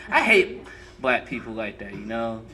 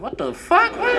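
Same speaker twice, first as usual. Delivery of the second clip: mock disbelief. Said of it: "I was like".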